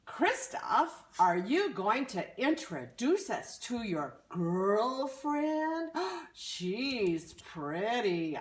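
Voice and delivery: high, eager troll voice; a troll teasing Kristoff about his girl; nosy troll. Acted. Troll asks about girlfriend.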